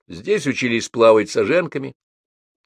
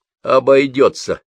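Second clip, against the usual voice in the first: louder cocky brush-off; defiant; 5 semitones higher, 5 dB louder than usual. A narrator's reading. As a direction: spit the word out defiantly. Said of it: "вызывающе сплюнул в бок Лёнька Жмых". (вызывающе, громче)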